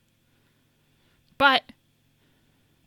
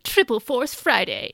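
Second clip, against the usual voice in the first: hammed-up hype announcer, key phrases punched louder; bizarre announcer voice; 2 semitones higher, 6 dB louder than usual. Podcaster reading a launch announcement to hype everyone up, bizarre announcer voice. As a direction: read it like an over-the-top product launch announcement, stretching big names. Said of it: "has this bizarre announcer voice?".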